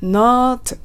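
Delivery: usual speaking voice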